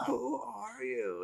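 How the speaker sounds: put-on high, soft, slow old woman; slow, hushed imitation of a frail old lady; softly voiced old woman, slow and high. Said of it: "You could hear an old lady say".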